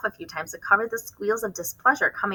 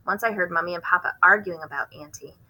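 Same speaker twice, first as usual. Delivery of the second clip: ordinary delivery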